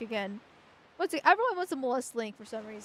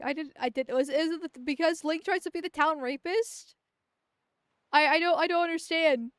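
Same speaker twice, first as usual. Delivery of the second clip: ordinary talk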